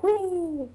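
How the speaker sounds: high-pitched whee of someone flying; high playful "wee". Retold or imitated. Host acting out watching her go flying, high mock squeal. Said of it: "I was like".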